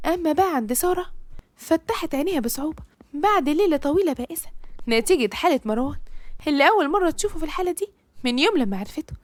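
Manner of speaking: ordinary delivery, nothing marked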